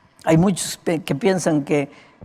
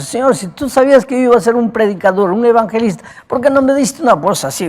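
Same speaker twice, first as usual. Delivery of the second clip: higher and louder, a pleading complaint; complaining to God; 7 semitones higher, 6 dB louder than usual. Retold or imitated. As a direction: cry out to the Lord, half protesting. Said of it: "Yo siempre le preguntaba a Dios".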